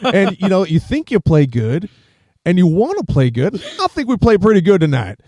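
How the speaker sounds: high, loud jock interview voice; sportsy athlete interview. Retold or imitated. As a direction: play a dim jock at the post-game mic. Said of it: "gives the most sportsy, like, interview ever".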